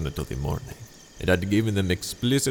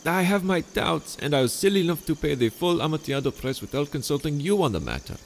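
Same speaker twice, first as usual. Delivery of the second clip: higher, sweetly scheming host voice; honeyed charm pitched up high; Montresor, falsely friendly; 9 semitones higher than usual. Acted. Montresor dialogue continues.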